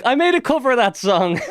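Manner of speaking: high sheepish confession; admitting he loves the song; sheepish admission. Retold or imitated. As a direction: own up embarrassed. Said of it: "And now I'm like".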